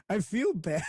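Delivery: real laughter over his own post; laughing. Real, not acted.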